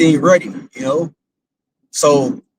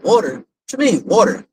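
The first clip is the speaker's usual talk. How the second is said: puzzled surprise; 6 semitones higher than usual. Retold or imitated. Puzzled father questions daughter's dream.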